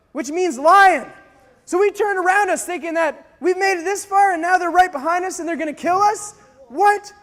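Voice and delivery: normal talking voice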